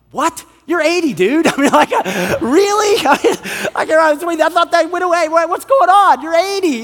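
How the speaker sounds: high, incredulous outburst; mock disbelief. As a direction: react in comic disbelief, pitch right up. Acts his stunned reaction to professor.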